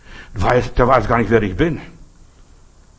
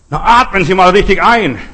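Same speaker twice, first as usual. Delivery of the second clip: high, loud, exasperated retort; mocking advice; 6 semitones higher, 9 dB louder than usual. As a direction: tell the fool off loudly. Answering the fool. (laut und spöttisch, deutlich höher)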